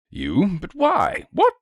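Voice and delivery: high-pitched baffled nobleman; spluttering. A narrator's reading. Nobleman learns who sent the wire, spluttering. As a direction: splutter the questions, pitch leaping up. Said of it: "The nobleman spluttered impotently".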